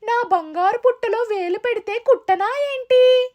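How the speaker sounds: high-pitched, indignant little ant; talking ant. Acted. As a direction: retort as a tiny storybook ant. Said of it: "చీమ వద్దకు వెళ్లి".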